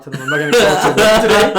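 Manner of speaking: half-laughing, pushing the request; urgent plea to paramedic. Retold or imitated. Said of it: "and I told the guy".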